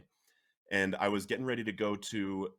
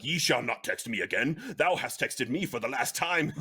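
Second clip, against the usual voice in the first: louder mock-archaic biblical pronouncement; hammed-up Bible-speak decree; lightly voiced scripture proclamation; King James English; 4 semitones higher, 4 dB louder than usual. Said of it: "King James".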